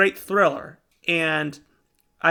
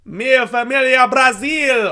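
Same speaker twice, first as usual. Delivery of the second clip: loud, raised-pitch movie-star impression; Vin Diesel; 7 semitones higher, 6 dB louder than usual. Host hamming up the game in Brazil, playful movie-star impression. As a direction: belt it out as a hammed-up action-star impression. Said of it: "trying to do my Vin Diesel impression".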